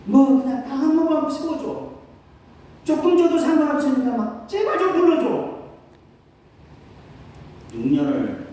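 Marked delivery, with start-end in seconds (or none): 0.1-5.6 high, loud pleading; mock desperate begging for an invitation; begging plea. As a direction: beg every pastor desperately. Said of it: "바지 끄댕이 잡고 늘어졌습니다".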